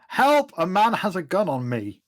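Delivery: high acted cry for help; yell for help. Woman at gunpoint calls to passers-by, yelling. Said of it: "and I yell".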